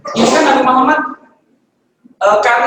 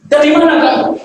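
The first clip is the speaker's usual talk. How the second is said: raised, loud demand from a furious father; father interrogating his late son, loud and angry; 6 semitones higher, 5 dB louder than usual. Acted. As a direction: snap the question at your son like an angry dad. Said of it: "karena marah, dia tanya".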